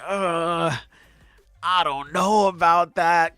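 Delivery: doubtful drawn-out reply; skeptical. Retold or imitated. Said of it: "But I'm like".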